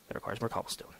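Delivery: unvoiced aside to self; whisper. Real, not acted. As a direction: whisper it under your breath.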